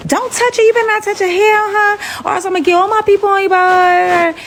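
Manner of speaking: high-pitched mock warning; imitated angry threat. Friend warning the kidnapper off, high mock fury.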